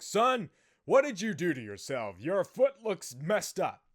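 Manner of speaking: loud, emphatic imitation of a dad; shocked dad. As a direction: play a dad exclaiming at an injury. Dad reacting to swollen foot.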